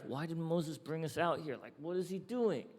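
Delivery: soft, half-hidden grumbling question; muttered grumble. Retold or imitated. Israelites in the desert muttering against Moses, softly. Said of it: "They muttered under their breath".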